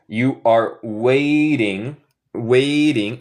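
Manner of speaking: "waiting" with a flapped, R-like T, drawn out. Shown as a demonstration. Teacher showing learners their own accent, T as R. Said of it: "En mi acento yo digo T más como R".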